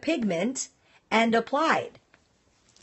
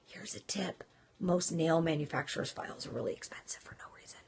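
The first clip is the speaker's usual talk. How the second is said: confiding whisper; 5 semitones lower, 12 dB quieter than usual. Real, not acted. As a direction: whisper the tip as if it's a secret. Shares an insider tip.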